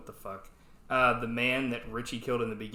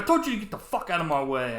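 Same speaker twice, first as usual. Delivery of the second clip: quick, raised mock tough-guy threat; mock angry warning; 5 semitones higher, 4 dB louder, faster than usual. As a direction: imitate a movie bully, rushed and higher. Acts out Seagal pushing bouncer.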